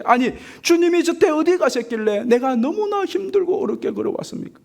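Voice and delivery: high, pleading protest, building up; complaining to the Lord. Acted. Dreamer arguing with Jesus on the beach, pleading protest. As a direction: argue with God, hurt and demanding. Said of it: "막 따지는 거예요".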